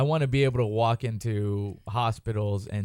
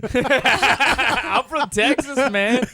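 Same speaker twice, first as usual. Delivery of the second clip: ordinary delivery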